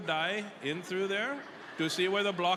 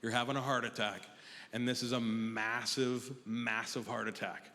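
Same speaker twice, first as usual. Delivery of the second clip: soothing lowered medic, slowing on massive; calm paramedic; 7 semitones lower, 5 dB quieter than usual. Retold or imitated. Paramedic calmly telling Taz he is having a heart attack, lower and soft.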